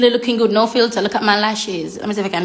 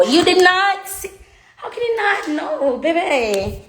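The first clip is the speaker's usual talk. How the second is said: playful disbelief; 7 semitones higher than usual. Real, not acted. Teasing disbelief at viewers.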